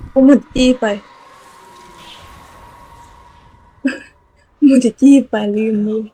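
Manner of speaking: loud exasperated outburst, then a laugh; frustrated shout. Real, not acted. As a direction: yell at yourself for forgetting. Ran out of items in game. (ตะโกนหงุดหงิดใส่ตัวเอง)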